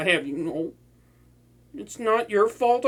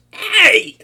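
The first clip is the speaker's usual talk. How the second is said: loud, higher yelp; indignant yelp; 10 semitones higher, 10 dB louder than usual. Acted. Villain slapped by the hero, loud indignant yelp. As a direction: protest the slap.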